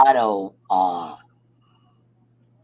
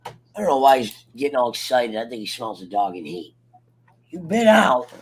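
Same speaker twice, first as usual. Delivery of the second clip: normal talking voice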